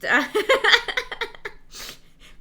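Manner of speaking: loud, high burst of laughter; real laughing fit, high and loud. Real, not acted. Streamer cracking up at skill check joke, laughing.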